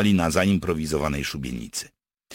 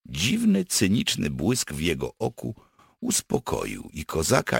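usual speaking voice

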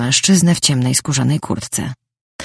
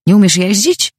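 higher, louder ironic question; 5 semitones higher, 5 dB louder than usual. A narrator's reading. Man asks the woman who hit the car, ironic. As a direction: needle with a sharply rising question. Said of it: "stwierdził z ironią".

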